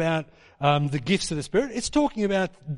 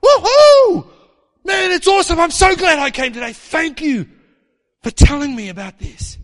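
loud, high whoop into gushing thanks; hammed-up elated crowd member, voice pitched way up; acted celebration; 9 semitones higher, 10 dB louder than usual. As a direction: whoop it up, then gush gratefully. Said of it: "they're celebrating with their family".

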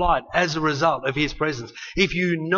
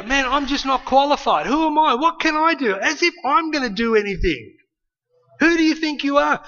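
high, louder, fretting inner voice; anxious self-doubt; 8 semitones higher, 3 dB louder than usual. Acted. Preacher voicing a believer's fearful self-talk, high anxious fretting. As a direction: voice the doubter who feels unqualified.

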